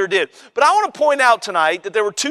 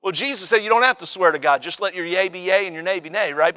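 ordinary talk

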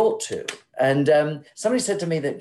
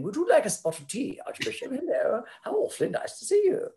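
mock upper-class English accent, much higher in pitch; exaggerated English accent; 13 semitones higher, faster than usual. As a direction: caricature of a genteel English host offering tea, pitched way up. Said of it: "I'll be a caricature of myself".

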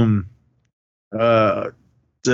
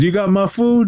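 higher-pitched "You got my food", as a challenge; questioning demand; 8 semitones higher than usual. Retold or imitated. Retelling a man challenging the other about food, questioning demand. Acted demand, answered by retort.

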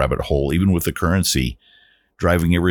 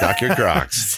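laughing; 4 semitones higher, much slower than usual. Pretend. Laughing while repeating the joke.

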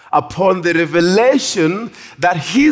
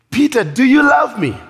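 higher-pitched, lightly acted appeal; earnest questioning; 7 semitones higher than usual. Jesus asks Peter about love, lightly voiced earnest questioning. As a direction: press the question on him.

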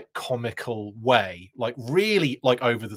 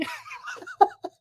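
squealing high laugh; high burst of laughter. Real, not acted. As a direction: break into a sharp high laugh.

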